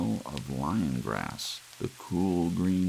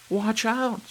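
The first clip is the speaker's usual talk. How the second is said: shrill, much higher full scream; 6 dB louder than usual. A narrator's reading. Lydia warning George of charging lions, screaming. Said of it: "screamed Lydia".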